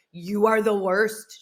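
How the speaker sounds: slow, drawn-out imitation of a friend's reproach; mock scolding. Friend complaining about a late callback, mock scolding. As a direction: stretch out the accusation in mock outrage.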